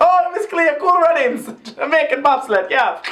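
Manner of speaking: put-on high-pitched botched Jamaican-Irish accent; hammed-up, high Jamaican accent sliding to Irish; Jamaican accent going Irish. Host mocking the Miss Cleo impression, botched Jamaican accent. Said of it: "You're going Irish".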